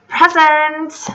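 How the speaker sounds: high, drawn-out call; shouting down. Acted. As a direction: sing out an answer from upstairs. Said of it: "to shout down".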